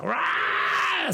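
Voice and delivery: a sudden high mock scream; retold scream. Reading the script's scream for the actress, high mock shriek. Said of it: "el libreto decía que ella gritaba".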